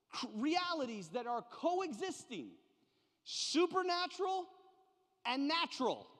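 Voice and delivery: high, insistent preaching with hammered key words; emphatic preaching. Real, not acted. Preacher revealing the burning bush's meaning, emphatic preaching. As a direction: drive the point home, pausing between key words. Preacher builds to his central revelation.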